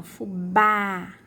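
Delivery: "fubá" slowly, mouth wide on á; "fubá" with a long, open, stressed á. Shown as a demonstration. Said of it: "bem a boca aberta".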